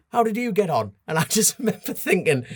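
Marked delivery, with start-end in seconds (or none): 0.1-0.9 dad suddenly chirpy. Retold or imitated. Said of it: "he just went".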